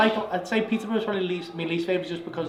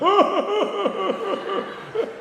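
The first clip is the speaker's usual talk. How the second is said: high, loud hooting mock laugh; imitated hysterical laughter; 6 dB louder than usual. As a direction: hoot like a crowd in fits. Audience pissing themselves, imitated.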